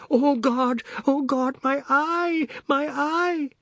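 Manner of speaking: very high cries of pain; howling in pain. Acted. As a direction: wail out in hurt. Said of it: "Mister Biswas howled".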